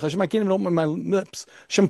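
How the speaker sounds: ordinary talk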